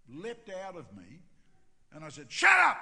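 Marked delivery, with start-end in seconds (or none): 2.3-2.8 sudden high, very loud outburst; explosive shout. Retold or imitated. Pastor blurting at the Texan without thinking, explosive shout. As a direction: blurt it out at full volume.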